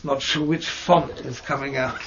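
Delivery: normal talking voice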